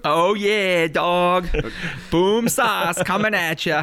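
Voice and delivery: hammed-up Boston accent. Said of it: "My Boston accent has gotten a lot better".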